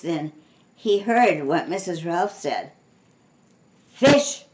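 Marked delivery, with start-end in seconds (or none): 3.9-4.4 high, loud outburst; shouting. A narrator's reading. Mister Rogers hears about the fish, shouting. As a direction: shout it in alarm. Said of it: "he shouted".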